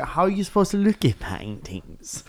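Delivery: put-on a mild German accent, slow and grand; lightly done German accent, museum welcome; German art curator. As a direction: play a snooty German gallery host. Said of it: "hot, like, German art curator".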